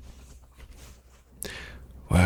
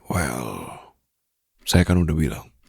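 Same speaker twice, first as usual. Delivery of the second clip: ordinary delivery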